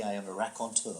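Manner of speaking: understated, self-mocking pomposity; pretentious. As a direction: announce yourself with mock grandeur, kept quiet. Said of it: "Um, pretentiously".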